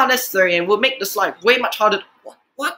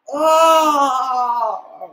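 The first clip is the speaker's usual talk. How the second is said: drawn-out cry of despair; despairing groan; 2 semitones higher, 4 dB louder than usual. Real, not acted. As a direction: groan at length in dismay. Despairing at the video.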